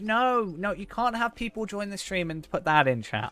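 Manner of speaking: hey no, laughing protest; playful protest. Real, not acted. Protesting chat command, playful alarm.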